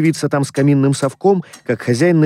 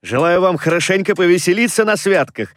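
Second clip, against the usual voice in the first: higher-pitched, jolly nephew's greeting; cheerful exclamation; 6 semitones higher than usual. A narrator's reading. Nephew bursting in to greet his uncle, cheerful exclamation. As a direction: wish it cheerily, voice lifted. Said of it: "раздался жизнерадостный возглас".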